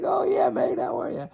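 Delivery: very high, breezy mock Aussie hello; picture Rafter recognizing him, cheery and high; imagined Aussie greeting. Rafter greeting an old media guy again, very high mock Aussie.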